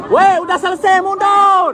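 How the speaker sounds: high urgent shout to back off; shouting warning. Real, not acted. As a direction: yell at them to pull back. Crowd near fire, warning people. (teriak menyuruh mundur)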